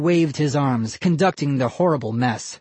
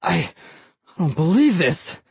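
stammered; 4 semitones higher than usual. Acted. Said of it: "Mister Barker stammered".

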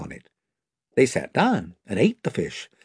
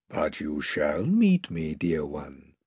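lower, slow, soft and loving; Aslan tender; 9 semitones lower, 6 dB quieter, slower than usual. A narrator's reading. Aslan reassures Lucy, low and tender. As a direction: comfort her gently.

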